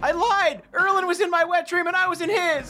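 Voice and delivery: high frantic screaming confession. Acted. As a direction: shriek it out, laughing. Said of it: "just scream".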